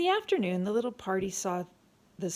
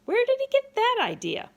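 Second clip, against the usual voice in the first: high, loud exasperated question; frustrated outburst; 9 dB louder than usual. A narrator's reading. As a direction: shout it in sheer exasperation. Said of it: "in frustration".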